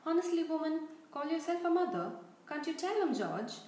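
loud, hammed-up outrage at mum; mock indignation. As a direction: play the wounded twin, loud and high. Twin mock-protests to his mother.

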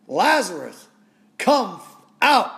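loud rising call to the tomb; shouting. Acted. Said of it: "Then Jesus shouted".

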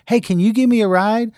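high, quick, loud request; imitating the girl. Girl asking a stranger for a ride, imitated high. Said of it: "And she goes".

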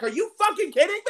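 high, loud incredulous yell; stunned disbelief. Retold or imitated. Fan to his cohost, retelling his stunned yell. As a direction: blurt it out, can't believe the kick went in. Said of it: "And I was like".

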